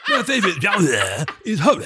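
high, laughing puppet-style voice; Sesame Street character. Retold or imitated. Teasing the co-host over his pronunciation, high puppet voice. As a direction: mimic the co-host as a giggly children's puppet. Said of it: "sounds like a Sesame Street character".

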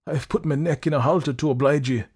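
hoarse. A narrator's reading. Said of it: "still somewhat hoarsely".

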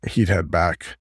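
ordinary delivery

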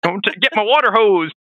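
put-on old prospector. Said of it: "He's like".

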